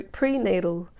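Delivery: usual speaking voice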